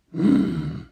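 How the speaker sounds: calm inhuman curiosity; cold ancient being. A narrator's reading. Cars voice.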